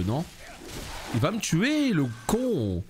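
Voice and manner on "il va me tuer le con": high panicked shout at the boss; panicked yelp. Real, not acted. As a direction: yelp in real alarm as he closes in. Boss about to kill him.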